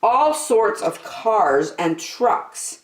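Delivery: put-on lively read-aloud for little kids; storybook narration. A narrator's reading. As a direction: tell it like a children's storyteller. Reading picture book aloud.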